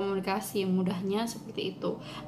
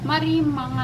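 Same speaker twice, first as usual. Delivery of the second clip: Malang Javanese, said louder and higher; 5 semitones higher, 6 dB louder than usual. Shown as a demonstration. Teacher demos the Malang phrase, louder Malang Javanese. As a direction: call it out like a Malang local. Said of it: "Kalau orang Malang biasanya menyebutkan".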